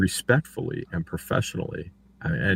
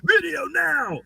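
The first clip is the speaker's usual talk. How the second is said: squeaky high mock-bossy demand; slower than usual. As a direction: mimic a bratty order in a high voice. Mocking Deuce's demands.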